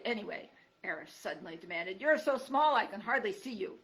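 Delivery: put-on loud, cranky old creature snapping; a crotchety porcupine woken up, annoyed; grumpy old porcupine. Gruff porcupine Aerith scolding.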